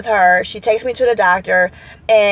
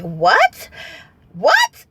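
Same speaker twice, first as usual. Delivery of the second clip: shocked disbelief. Retold or imitated. Patient reacting to news of an allergic reaction, shocked. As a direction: ask in disbelief, pitch shooting up.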